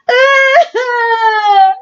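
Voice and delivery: put-on high, loud baby cry; high crying wail; toddler wail. A narrator's reading. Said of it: "He cries".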